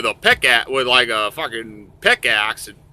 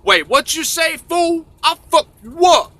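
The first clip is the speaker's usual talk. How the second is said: high-pitched mock woman; put-on woman; 3 dB louder than usual. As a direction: squawk it high in a falsetto. Voicing an angry woman on stage.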